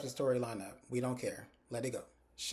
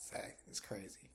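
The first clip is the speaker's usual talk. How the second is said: hushed aside; 3 semitones lower, 11 dB quieter than usual. Retold or imitated. Aside to a friend mid-story.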